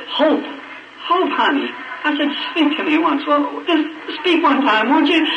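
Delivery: high, pleading call to a dying wife; desperate pleading. Retold or imitated. Begging his dying wife to speak.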